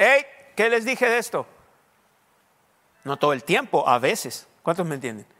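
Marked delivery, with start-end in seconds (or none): 0.0-1.5 a mildly raised scolding. Retold or imitated. Father mildly scolding his children. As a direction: lightly raise the voice at the kids. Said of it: "subir un poquito la voz y decir".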